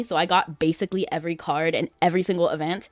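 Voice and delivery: usual speaking voice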